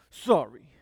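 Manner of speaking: imitating a child's unwilling apology; indignant sorry. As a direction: toss off the apology like a sulky kid. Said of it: "and be really indignant about it".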